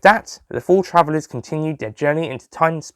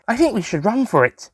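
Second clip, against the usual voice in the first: put-on girl voice; 10 semitones higher than usual. A narrator's reading. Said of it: "said Bridget".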